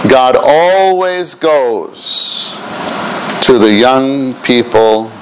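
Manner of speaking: very slow, stretched words; dictating. Shown as a demonstration. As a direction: draw out each word for writing. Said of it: "please write this".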